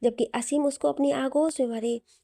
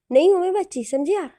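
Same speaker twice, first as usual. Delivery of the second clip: louder, higher, stamping her point; indignant protest; 7 semitones higher, 7 dB louder than usual. A narrator's reading. Hina protesting to Asim that she is not a child, indignant and loud. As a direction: insist hotly you're no child.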